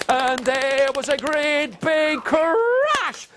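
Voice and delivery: slow, climbing to a high crash; dramatic build-up. A narrator's reading. Storyteller bringing the magic to children, slow rising build-up. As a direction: stretch each word, rolling into the final one.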